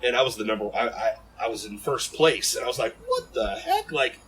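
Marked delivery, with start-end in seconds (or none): 3.1-3.9 stunned. Retold or imitated. Retold shock at first place.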